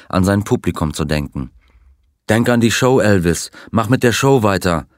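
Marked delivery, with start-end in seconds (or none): none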